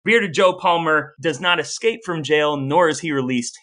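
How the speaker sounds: usual speaking voice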